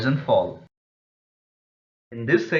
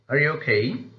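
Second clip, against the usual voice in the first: "Are you okay" climbing steeply on "okay"; rising tone; 3 semitones higher than usual. Shown as a demonstration. Teacher models a yes or no question, rising tone. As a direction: ask it with "okay" swung upward. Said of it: "in the case of yes or no questions".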